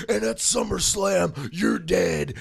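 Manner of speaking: high, loud mock wrestler trash talk; wrestler promo. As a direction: ham up a wrestler threatening on the mic. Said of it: "The wrestlers are like".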